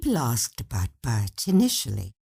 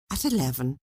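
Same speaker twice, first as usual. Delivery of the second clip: plain reading voice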